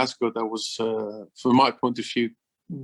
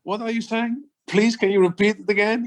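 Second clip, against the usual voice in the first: higher, puzzled plea to repeat; baffled newcomer; 6 semitones higher than usual. Retold or imitated. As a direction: play the lost foreigner begging for a repeat. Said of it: "and he say".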